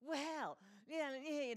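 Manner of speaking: high, loud mock huff of a little girl; put-on affronted kid voice; offended child. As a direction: imitate a playmate taking offense. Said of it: "she took offense".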